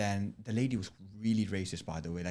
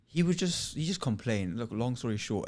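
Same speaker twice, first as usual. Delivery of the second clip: ordinary talk, nothing marked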